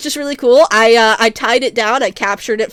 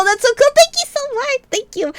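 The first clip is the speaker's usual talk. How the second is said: excited thanks; 11 semitones higher than usual. Real, not acted.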